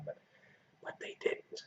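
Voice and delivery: breathy whispered aside; whispering. Real, not acted. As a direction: drop to a whisper. Aside while pets leave.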